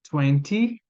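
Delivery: "twenty" with a crisp, full T; clearly marked. Shown as a demonstration. Teacher modelling a careful "twenty" for students. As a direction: hit the T in "twenty" hard. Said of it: "você precisa falar bem marcado".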